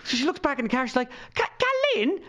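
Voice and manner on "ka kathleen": high, hesitant name check; imitating Spanish-speaking mother. Perla's mother checks the name in the car, imitated high and unsure. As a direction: stumble into the name, pitched way up.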